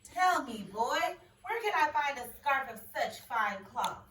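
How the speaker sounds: a lightly voiced demanding king. King questioning the boy, lightly demanding. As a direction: ask like a greedy king.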